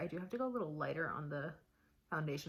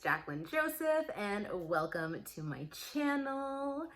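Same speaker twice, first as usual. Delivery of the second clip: vlog intro; 6 semitones higher, 13 dB louder, slower than usual. Acted.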